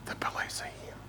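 put-on hushed child's whisper on the phone; little boy whispering. A narrator's reading. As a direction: keep it secret, breath only. Hiding child answers the pastor.

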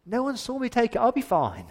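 high, nervous reassurance; nervous self-talk. Retold or imitated. Achan telling himself nobody saw him, nervously. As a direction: talk yourself out of panic.

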